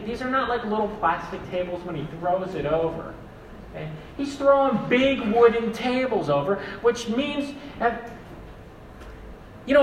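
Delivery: normal talking voice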